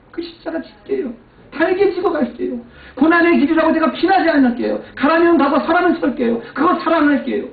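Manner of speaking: high, loud fervent promise; fervent vow. Retold or imitated. Pastor reliving his vow to God, loud fervent prayer. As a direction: cry out your surrender to God.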